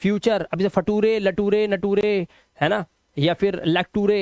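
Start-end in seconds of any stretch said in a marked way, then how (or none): 0.0-0.5 "फ्यूचर" as fyoo-chər; फ्यूचर with neutral -ər ending; correct "फ्यूचर". Shown as a demonstration. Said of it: "अर से पढ़ना है". 0.7-3.6 "फटूरे, लटूरे, नटूरे" with too-RAY endings; mock misread फटूरे, लटूरे, नटूरे letter by letter; wrong spelling reading. Shown as a demonstration. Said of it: "ऐसा नहीं पढ़ना है". 3.7-4.2 "लटूरे" with a too-RAY ending; wrong spelling reading. Shown as a demonstration. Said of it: "ऐसा नहीं पढ़ना है".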